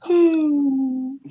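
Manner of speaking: short giggly nervous laugh. Real, not acted. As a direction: laugh nervously before speaking. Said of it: "That's my I'm nervous laugh".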